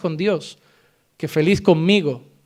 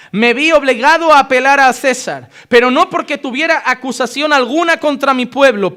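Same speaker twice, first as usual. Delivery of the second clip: lectura solemne y sonora del versículo; lectura bíblica; 6 semitones higher, 6 dB louder than usual. Acted. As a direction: proclama el texto en voz alta. Lee el texto de Hechos. (lectura bíblica proclamada)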